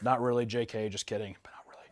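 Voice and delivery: whispered aside; whispering. Real, not acted. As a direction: whisper the joke under your breath. Whispered aside about Lacey.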